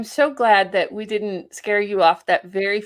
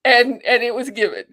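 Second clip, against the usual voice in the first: louder, choked-up marvel; tearful awe; 3 semitones higher, 8 dB louder than usual. Real, not acted. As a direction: say it through rising emotion. Moved by guest's story.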